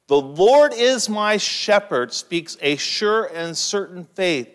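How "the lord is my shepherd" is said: loud proclamation. Shown as a demonstration. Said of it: "quoted psalm proclaimed".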